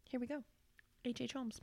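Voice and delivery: hushed, ominous name reveal; dramatic hush. Acted. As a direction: let the name hang, softly.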